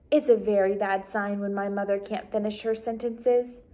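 ordinary delivery

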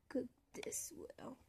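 hushed whisper. Real, not acted. Cook whispering to the food on the fire. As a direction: whisper it under your breath. Whispered aside while cooking.

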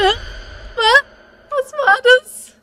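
sharp, high and loud; startled question. Real, not acted. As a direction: jump at the noise. Sudden noise in the game. (erschrocken, laut)

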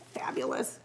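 whispered praise; tiny whispered fabulous. Real, not acted. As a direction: breathe the praise softly. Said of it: "praise whispered".

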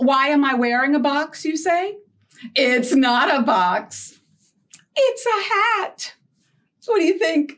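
usual speaking voice

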